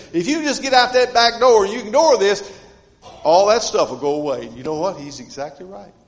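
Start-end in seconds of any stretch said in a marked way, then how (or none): 0.1-4.5 loud, high, coaxing devil's whisper turned shout; tempting devil. Acted. Preacher voicing the devil luring sinners out the back door, loud tempting call. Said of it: "the devil's on the other side and he's saying".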